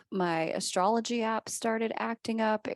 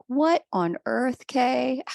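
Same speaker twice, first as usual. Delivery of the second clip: incredulous twin; 6 semitones higher, 5 dB louder, slower than usual. Retold or imitated. Twin reacting to the weather witch idea.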